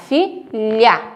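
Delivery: FILHA broken into fi, lha; open-mouthed lh. Shown as a demonstration. Teacher confirming the lh spelling, careful syllables. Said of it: "você abre a sua boquinha".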